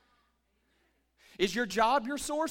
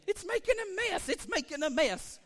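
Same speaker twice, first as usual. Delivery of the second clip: lightly voiced fussy pessimist, first line higher; 4 semitones higher than usual. Pastor mocking the pessimist's complaint, mild whiny mock. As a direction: mimic a griping pessimist. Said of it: "the pessimist would say".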